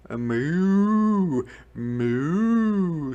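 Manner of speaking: high, drawn-out moo; cow mooing. A narrator's reading. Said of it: "said the spotted cow".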